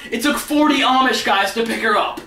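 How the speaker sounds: ordinary talk, nothing marked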